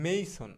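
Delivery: মেইসন with a long gliding "ei" in the first syllable; corrected pronunciation. Shown as a demonstration. Teacher modelling the English for mason, মেইসন with an "ay" glide. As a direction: say মেইসন as "MAY-son". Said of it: "আমরা এটাকে বলবো হচ্ছে".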